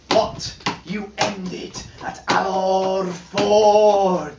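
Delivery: plain reading voice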